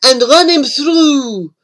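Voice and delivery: loud high yoo-hoo, then a threat; mocking scream. A narrator's reading. French mob screaming as they charge the snail, loud taunting call. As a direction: taunt them with a shrill call. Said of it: "They screamed".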